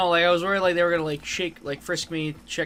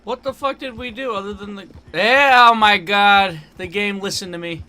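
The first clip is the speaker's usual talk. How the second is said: loud, high shout of disbelief; real excited outburst; 6 semitones higher, 12 dB louder than usual. Real, not acted. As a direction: blurt it out amazed the game reacted. Game reacted to his words.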